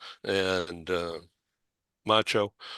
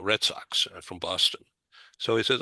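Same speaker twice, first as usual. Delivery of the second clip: normal talking voice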